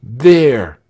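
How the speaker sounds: a loud irritated grumble; grumbled. A narrator's reading. Leuthold grumbling to Frieshardt about the jeers, loud and fed up. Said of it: "grumbled Leuthold".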